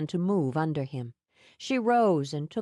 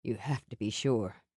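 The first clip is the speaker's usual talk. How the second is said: lower, softer, serious; low earnest Sam; 8 semitones lower, 4 dB quieter than usual. A narrator's reading. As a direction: warn her gently, low. Sam cautioning Mia.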